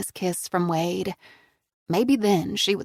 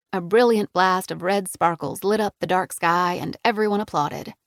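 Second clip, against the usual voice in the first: ordinary delivery, nothing marked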